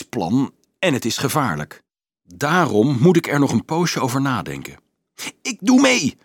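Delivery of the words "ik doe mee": very high, impulsive yelp; shrieking. A narrator's reading. Carolus joining the plan unthinkingly, shrieking. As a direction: shriek it without thinking. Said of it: "gilde Carolus zonder nadenken".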